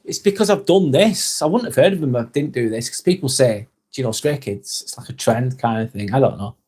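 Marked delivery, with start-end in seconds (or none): 3.9-4.8 low mumbled quote. Retold or imitated. Streamer mimicking people who always ask, low and soft. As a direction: drop it low and soft, a throwaway. Quoting what people keep asking.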